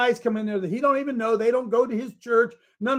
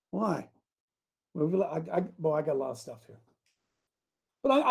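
ordinary talk

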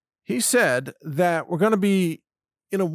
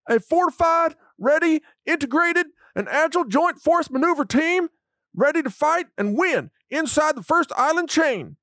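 much higher, louder Southern drill instructor; Southern drill sergeant; 13 semitones higher, 3 dB louder than usual. Retold or imitated. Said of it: "in a Southern drill sergeant voice".

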